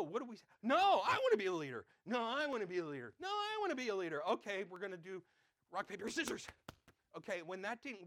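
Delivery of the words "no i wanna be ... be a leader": loud, repeated playground demand; child refusing to give up being leader; insistent kid. Retold or imitated. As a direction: keep insisting like a stubborn child. Kids insisting at recess.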